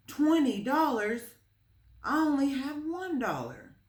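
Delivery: put-on high, loud dismayed boy; a boy stunned by a twenty dollar price, high and dismayed; shocked disbelief. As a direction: gasp at the price tag.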